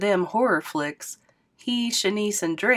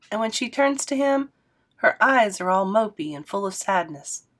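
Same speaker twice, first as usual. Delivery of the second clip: usual speaking voice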